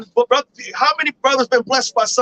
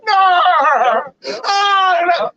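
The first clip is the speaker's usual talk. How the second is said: wailing; 4 semitones higher, 4 dB louder, slower than usual. Acted. Esau voiced weeping loudly.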